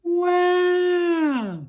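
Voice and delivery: put-on high loud baby cry; baby wail. Narrator voices the crying baby on the plane.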